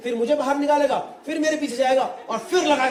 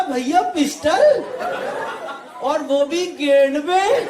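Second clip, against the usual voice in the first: mock shocked disbelief; 4 semitones higher, much slower than usual. The comic pleading with the gunman, mock shocked. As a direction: drag it out in stunned disbelief. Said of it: "मैं कहा".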